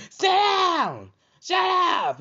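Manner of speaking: high, piercing imitated shout; yelling at kids. Mother yelling at children across the room, loud high shout. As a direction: scream it across the room, mimicking a parent. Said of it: "They yelling at them across the room".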